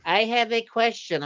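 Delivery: mock mother voice; mimicking the mother. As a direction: pipe up like a nagging mother. Mother-in-law voiced in story.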